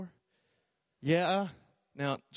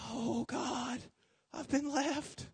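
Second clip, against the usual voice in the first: mock panic; 6 semitones higher, 8 dB quieter, much slower than usual. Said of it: "I'm like".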